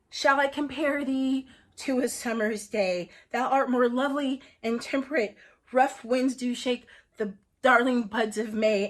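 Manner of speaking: sonnet recitation. Acted. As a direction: woo a spouse with grand verse. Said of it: "I wrote a sonnet for you".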